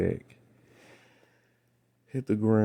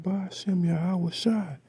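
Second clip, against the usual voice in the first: pitched way up, calling the holy name; high cried-out name; 10 semitones higher than usual. Retold or imitated. To the attacker in a dream, a high cried-out invocation. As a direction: cry the name out high and bold. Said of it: "and I said".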